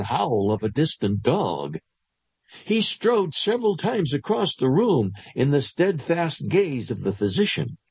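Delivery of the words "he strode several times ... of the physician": ordinary delivery, nothing marked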